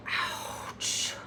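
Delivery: hushed, voiceless reaction; whispered ouch. Real, not acted. Recapper winces at a cruel breakup line, whispered.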